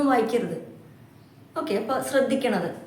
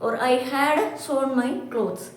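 slow example reading; much slower than usual. Shown as a demonstration. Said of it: "മൂന്നാമത്തെ form".

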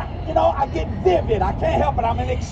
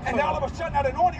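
usual speaking voice

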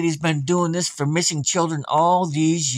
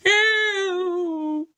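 put-on very high small girl's plea; little girl pleading; much slower than usual. Samantha begging her mother for help, very high. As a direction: beg like a frightened little girl. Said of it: "she was saying".

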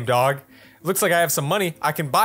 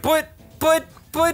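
sputtering protest; 12 semitones higher, slower than usual. Real, not acted.

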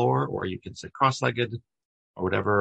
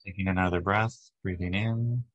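even, unhurried meditation voice with long pauses; guided meditation. Acted. Leader guides a group to relax body and breath, guided meditation. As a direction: guide them gently through each breath, leaving space between phrases.

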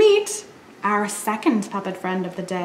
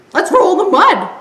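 louder giggly invite; laughing dog; 7 semitones higher, 6 dB louder than usual. Acted. Dog inviting piglet to roll in mud, laughing.

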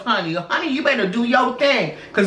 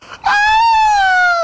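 very high, loud held "ah"; mock shriek; 6 dB louder than usual. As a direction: let out a high-pitched scream. Shriek amid movie-scene bit.

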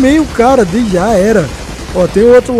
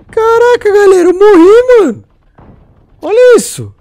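higher, louder cry of dismay; real gamer alarm at dying; panicking gamer; 10 semitones higher, 5 dB louder than usual. Real, not acted. Realizes he died in match.

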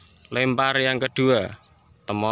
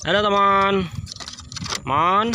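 coaxing pet voice; 8 semitones higher than usual. Real, not acted. Owner greeting his pigeon Temon, high coaxing call.

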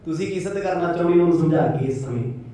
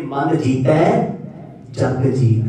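scripture recitation; 4 semitones lower, 4 dB louder, much slower than usual. Real, not acted. Preacher recites scripture line.

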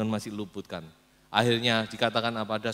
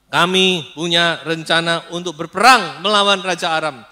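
loud, raised kingly announcement; royal announcement; 6 semitones higher, 14 dB louder than usual. Acted. Ahab addresses four hundred prophets, loudly proclaiming. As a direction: proclaim it to the whole court.

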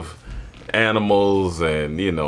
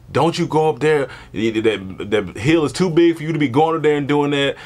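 mom's warning; 6 semitones higher than usual. Retold or imitated. Mom warning the child.

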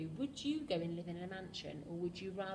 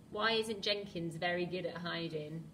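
ordinary delivery, nothing marked

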